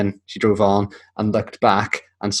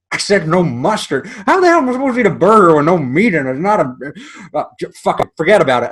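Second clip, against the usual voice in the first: mock angry rant; 8 semitones higher than usual. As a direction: rant like a furious customer. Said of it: "And he goes".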